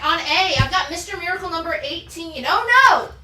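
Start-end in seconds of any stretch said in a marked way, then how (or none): 2.5-3.1 loud, high cry of pretend dread; mock horror. Seller reacting to a comic cover in hammed-up horror. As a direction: play up the alarm, high and loud.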